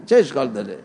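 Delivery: ordinary delivery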